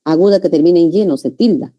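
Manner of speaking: ordinary talk, nothing marked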